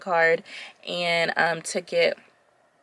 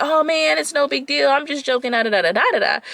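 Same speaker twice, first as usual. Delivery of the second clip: high, loud careless bro voice; imitating the date shrugging off the complaint; mock frat boy; 6 semitones higher, 3 dB louder than usual. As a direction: brush it off like a cocky frat boy.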